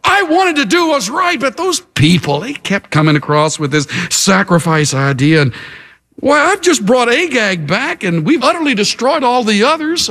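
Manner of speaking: high-pitched, protesting excuses; defensive King Saul. Acted. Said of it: "Look at that resistance to accountability".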